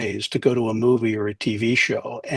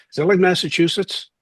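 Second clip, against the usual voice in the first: higher-pitched puzzled Philly question; Philly accent; 7 semitones higher than usual. Retold or imitated. Friend puzzled about New Hampshire, Philly accent. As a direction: mimic a clueless Philly tough kid. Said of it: "he had the Philly accent".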